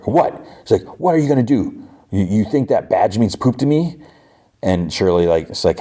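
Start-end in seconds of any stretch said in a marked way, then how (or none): 0.0-0.3 high, incredulous cut-in; challenging interruption. Retold or imitated. Retelling Knox cutting Paul off mid-threat, indignant. 1.0-4.0 high-pitched acted indignation, not shouted; defiant challenge. Knox challenges Paul over his badge, defiant.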